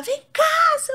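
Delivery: high shouted call to come here; sharp high "Vem cá" shouted across the yard; retold yell. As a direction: yell it like calling a child over. Calling kids, retold yell.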